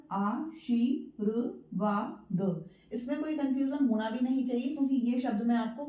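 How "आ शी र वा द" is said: आ, शी, र, वा, द split slowly into separate syllables; broken into syllables for the class. Shown as a demonstration. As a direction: spell out आशीर्वाद one slow syllable at a time. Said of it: "हमने बोला आशीर्वाद".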